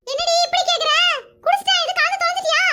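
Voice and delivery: high-pitched suspicious alarm; alarmed demand. Acted. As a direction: demand to know, voice jumping up. Alarmed the juice was spiked.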